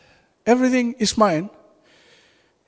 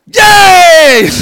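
excited cheer; 8 semitones higher, 12 dB louder than usual. Real, not acted. Joyful cheer at co-heirs.

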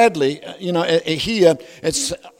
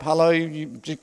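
ordinary talk, nothing marked

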